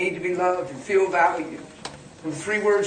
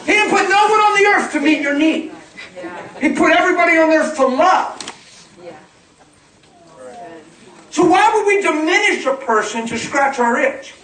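full-force preaching; 6 semitones higher, 4 dB louder than usual. Real, not acted. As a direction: drive it home to the congregation, high and forceful.

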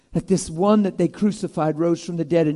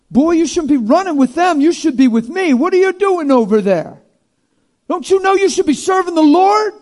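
loud, high, scolding preachy voice; mock scolding; 9 semitones higher, 8 dB louder than usual. An imagined scolding Jesus rebuking Malchus, loud and high. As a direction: lecture him like a scold.

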